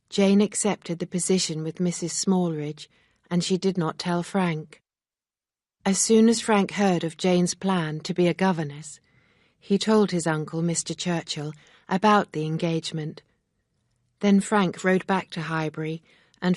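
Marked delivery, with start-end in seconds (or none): none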